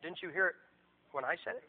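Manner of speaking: wounded pastor thinking to himself; quiet, let-down question under the breath; deflated private thought. Retold or imitated. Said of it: "And I thought".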